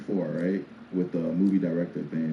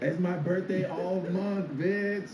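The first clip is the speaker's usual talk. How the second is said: mock diva; 11 semitones higher, much slower than usual. Mocking a birthday person.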